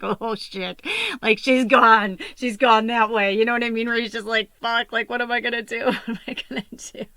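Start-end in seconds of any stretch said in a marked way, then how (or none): 0.0-3.3 louder, higher alarmed outburst; imitated panic. Imitating boyfriend's panic when the dead dog went limp. 4.6-7.0 higher, louder panic breaking into a laugh; laughing mock panic. Imitating boyfriend's helpless panic, laughing at the memory.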